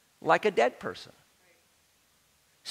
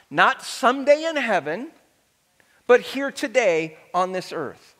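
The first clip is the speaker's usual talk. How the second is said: ordinary delivery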